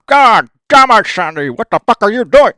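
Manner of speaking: dad roaring at mom after the blast; furious yell. Retold or imitated. Drunk dad yelling at wife over exploded car. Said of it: "Like this, right?".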